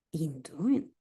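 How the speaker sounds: whispered trial reading; whispering. Real, not acted. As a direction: whisper it to yourself. Trying answer options under breath.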